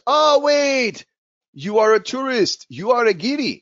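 high, loud mock discovery; sudden realisation. Helper realises listener is tourist.

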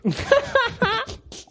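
a high burst of real laughter. Real, not acted. Laughing at the villagers' virus theory, high laugh. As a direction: break into laughter at the story.

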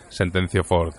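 plain reading voice

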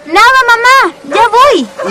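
calling out. Acted. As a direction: call out to mom from the door.

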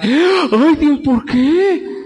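lamento exagerado y lento; a hammed-up cry over lost works; mock lament. Predicador imita al que pierde obras. (lamento fingido y lento)

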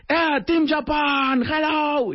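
high, slow, drawn-out greeting call. Retold or imitated. Hailing the Japanese team at the break, sing-out call. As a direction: re-enact hailing a whole group, stretching each word. Calling to group at every break.

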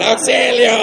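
high-pitched mock scream for help; mock cry for help. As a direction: squeal it out as a joke.

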